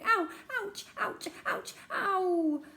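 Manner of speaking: put-on boy yelping ouch; yelling in pain. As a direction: hop and yelp with each step. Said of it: "and yelling".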